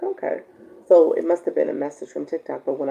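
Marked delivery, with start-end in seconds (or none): 0.0-0.4 a sudden high-pitched okay; high puzzled okay. Retold or imitated. Host recalling her reaction to a strange message, high and baffled. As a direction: squeak it out, baffled. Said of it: "I was like".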